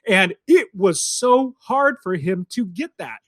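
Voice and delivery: ordinary talk, nothing marked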